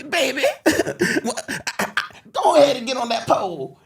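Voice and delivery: high-pitched put-on movie character voice; Wesley Snipes. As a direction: push it way up high for a hammed-up impression. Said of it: "does this voice and goes".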